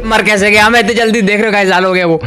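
high, startled cry of dismay; shocked disbelief. Real, not acted. Gamer dying instantly in horror game, high startled outcry. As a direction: sound like you can't believe you died.

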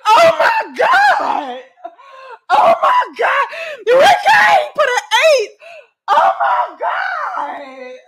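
high, loud, drawn-out wail; hammed-up anguished screaming. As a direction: shriek it in over-the-top grief. Mock-grief outburst over Ricky.

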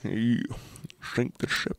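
hushed, unvoiced captain's order; Russian sub captain. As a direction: breathe the order like a submarine captain. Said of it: "The perfect Russian submarine captain".

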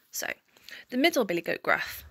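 usual speaking voice

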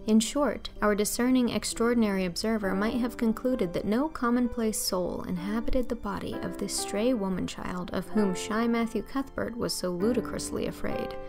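ordinary delivery

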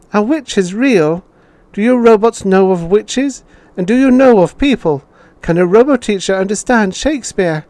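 put-on very high, loud child's questions; child pressing the robot teacher with questions in a very high, loud voice; curious child. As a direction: pester the teacher with wonder.

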